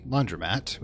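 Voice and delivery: normal talking voice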